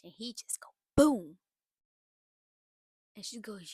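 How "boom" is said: loud, sudden imitated punch; mock punch sound. Retelling the husband striking his wife, a loud boom. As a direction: bark out the impact noise.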